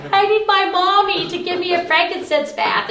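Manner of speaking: high-pitched mock whine, mimicking a sick son; whiny. Mother mimicking her sick married son wanting his mommy, whiny. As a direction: whine like a pampered, poorly child. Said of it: "he gets all whiny and is like".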